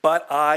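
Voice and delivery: "But I" pushed out loud and strong; emphasis on but I. Shown as a demonstration. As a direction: lean hard on "But I". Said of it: "emphasis here".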